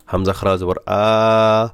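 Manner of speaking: আ held long and slow; drawn recitation. Shown as a demonstration. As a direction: stretch আ like Quran reading. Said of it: "এখানে টান হবে".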